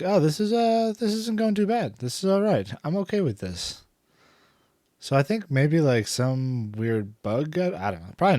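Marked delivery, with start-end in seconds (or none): 0.0-3.8 higher, lighter self-quote; quoting himself. Retold or imitated. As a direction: lift the voice for the inner thought. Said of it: "And now I'm like".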